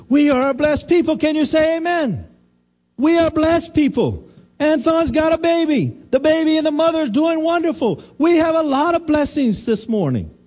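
high, ringing preacher's proclamation; fervent preaching. Real, not acted. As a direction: lift it up and proclaim the blessing. Preaching at full force, raised pitch.

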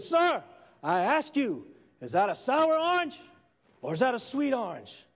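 loud, high-pitched insistent questioning; forceful demand. Acted. Preacher asks the riled atheist a third time, forceful demand. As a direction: hammer the question at a stubborn opponent. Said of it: "Finally, the third time".

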